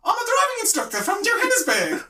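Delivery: very high, loud, hammed-up South African; South African accent. Drunk husband bluffing to a couple, South African accent. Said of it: "talking like this".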